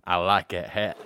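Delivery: friendly southern drawl, a little low; lightly voiced Southern stranger. Stranger at Walmart greeting him in a southern accent. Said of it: "southern accent shit".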